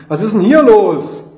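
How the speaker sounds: loud, high, drawn-out call; Rufen von weitem. A narrator's reading. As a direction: call out from far away. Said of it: "von weitem hören wir den Kerb rufen". (laut von weitem gerufen)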